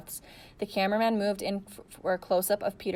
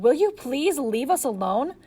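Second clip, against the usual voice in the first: higher, louder, slow shout; 6 semitones higher, 6 dB louder, slower than usual. A narrator's reading. Girl shouting at pushy journalists to leave, exasperated. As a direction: shout them away, fed up. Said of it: "She shouted".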